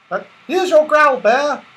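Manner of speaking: very high, loud pixie voice; little pixie. Acted. Pixie running up to Moonface, very high and loud. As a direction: chirp it out eagerly.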